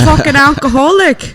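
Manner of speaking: mock Irish accent.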